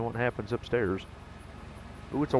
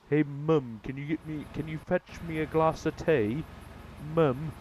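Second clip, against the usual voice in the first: a light mock British accent, higher; 5 semitones higher than usual. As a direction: imitate a Brit asking mum for tea. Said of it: "You say mum".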